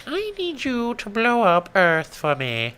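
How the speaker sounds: put-on high, slow girly alien voice; high-pitched alien lady asking sweetly; alien girl voice. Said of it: "she's like".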